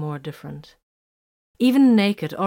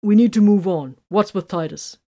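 commanding; 2 semitones higher, 8 dB louder than usual. A narrator's reading. Tiberius taking command.

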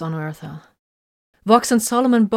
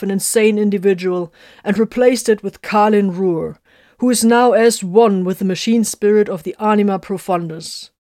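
proud proclamation; 2 semitones higher, 6 dB louder than usual. Acted. Techmarine proclaims victory to his comrades, loud and ceremonial. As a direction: declaim it like a triumphant herald.

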